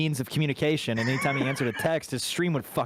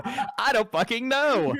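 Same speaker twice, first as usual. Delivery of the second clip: paranoid laughing; 8 semitones higher, much slower than usual. Retold or imitated. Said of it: "I was like".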